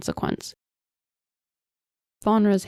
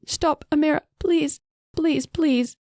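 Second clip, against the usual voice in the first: much higher, pleading and frantic; desperate plea; 9 semitones higher than usual. A narrator's reading. Sister begging Amira to stop beating queen, frantic pleading. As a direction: beg her to stop, near tears.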